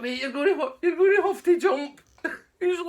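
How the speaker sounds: high, loud, cracking up; laughing through it. Retold or imitated. Teller tells Bones to jump while laughing loud. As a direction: try to give advice while laughing.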